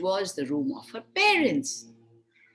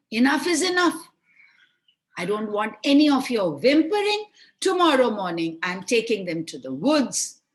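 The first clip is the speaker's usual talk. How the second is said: harsh scolding; 2 semitones higher, 5 dB louder than usual. Acted. Stepmother silencing her whimpering husband, angry. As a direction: snap at him, no arguments. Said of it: "her mother say".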